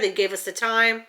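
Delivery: usual speaking voice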